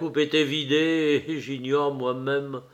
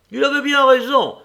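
high, loud officer's shout; a merry officer shouting agreement, high and loud; loud exclamation; 11 semitones higher, 8 dB louder than usual. Acted. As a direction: bellow it out like a jolly officer. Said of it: "s'écria l'un d'eux".

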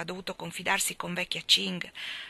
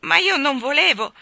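desperate protest; 7 semitones higher, 10 dB louder than usual. A narrator's reading.

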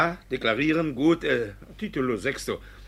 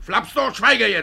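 sharp order; 9 semitones higher, 7 dB louder than usual. Acted. Judge silences Ruprecht.

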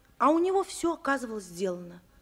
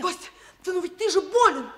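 raised, urgent insistence; 7 semitones higher, 4 dB louder than usual. Acted. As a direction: press him urgently. Urgent reminder he is sick.

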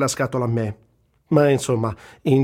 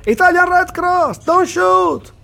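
very high, loud desperate shout, slowed; yelling from behind a lamp post at the tank crew; shouting in English; 7 dB louder, much slower than usual. Retold or imitated. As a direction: call out to the tank crew, begging. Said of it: "e urlo".

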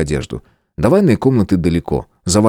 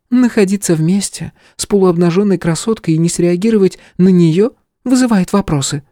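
put-on teasing young woman; 12 semitones higher than usual. Stella keeps teasing.

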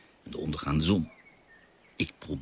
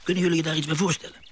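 put-on high, quick and eager, hooking the children; eager storyteller; 10 semitones higher, faster than usual. Father thrilling his children with the Beagle story, high and fast. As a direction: lean in and race through it with wonder.

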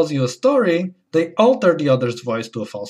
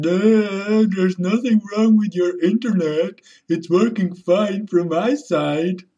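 high dopey voice, slower at the end; stupid falsetto; 6 semitones higher, slower than usual. Retold or imitated. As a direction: play the tech guy as a high-voiced dimwit. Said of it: "the stupid falsetto voice".

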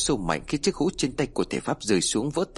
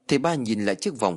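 plain reading voice

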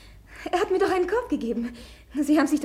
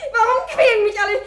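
laughing through tears; 5 semitones higher, 5 dB louder than usual. Acted. Hysterical outburst refusing marriage.

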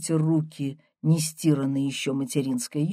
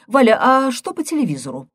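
fast, higher, louder change of subject; granny hurries to end the quarrel, fast; hasty; 9 semitones higher, 7 dB louder, faster than usual. A narrator's reading. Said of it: "поспешно заинтересовалась бабка".

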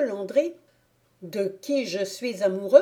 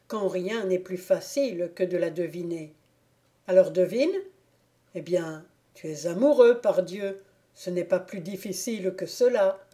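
ordinary delivery

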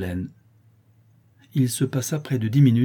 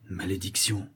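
muttered curse; 7 dB quieter than usual. A narrator's reading. Matteo curses to himself at the news, very quietly. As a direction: say it softly, almost to oneself. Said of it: "dit tout bas Matteo".

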